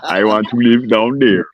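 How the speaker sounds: slow, drawn-out movie-quote voice; imitated movie character. Quoting the Buckingham Palace picture scene to friends, slow character imitation. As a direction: savour each word as the film character. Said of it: "He says".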